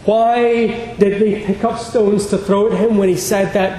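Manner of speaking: ordinary talk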